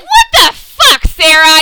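high, loud, laughing fake rage; hammed-up furious outburst; mock angry yell. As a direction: yell it at Sarah half laughing. Retelling Diggle yelling at Sarah.

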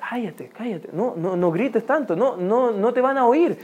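hushed, urgent shushing of someone; hushing. Acted. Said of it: "como tratando de acallar la gente". (callando a alguien en voz baja)

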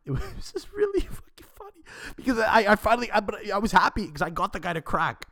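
high-pitched, breaking into real laughter; laughing while talking. Real, not acted. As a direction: squeeze the words out through delighted laughter. Cracks up recalling the outburst.

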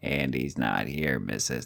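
attempted low, slow movie-character voice; Tony voice. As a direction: drop way down low and drag the words. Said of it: "I can't do the Tony voice".

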